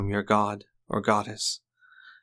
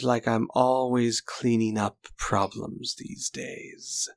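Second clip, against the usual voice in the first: villain's taunt; 2 semitones higher than usual. Acted. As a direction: purr it like a smug killer enjoying the moment. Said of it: "and goes".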